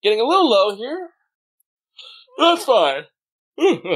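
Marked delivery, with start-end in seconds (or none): 3.6-4.0 yawning. Real, not acted. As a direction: yawn slipping into speech. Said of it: "I yawned".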